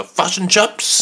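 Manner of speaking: "fush and chups" high and drawn out, U for each I; hammed-up New Zealand vowels on "fush and chups"; New Zealand accent. Shown as a demonstration. Showing co-host the accent, exaggerated New Zealand vowels. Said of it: "is how New Zealanders say fish and chips".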